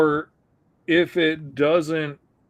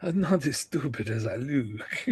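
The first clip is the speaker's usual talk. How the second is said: soft, dim-witted mumble; a lightly voiced dopey alien; dopey creature voice; 3 semitones lower, 7 dB quieter than usual. Voicing the derpy creature.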